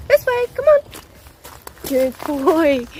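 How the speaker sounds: high, bright sing-song call to the dog. Real, not acted. Calling her dog back on the walk, high sing-song.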